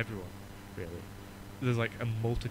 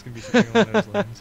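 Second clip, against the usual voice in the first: laughing; 9 semitones higher, 13 dB louder, faster than usual. Real, not acted. Laughing after Yorkshire impression.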